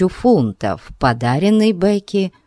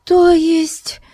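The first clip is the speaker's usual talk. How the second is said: frightened woman; 14 semitones higher than usual. A narrator's reading. Tense exchange with wife.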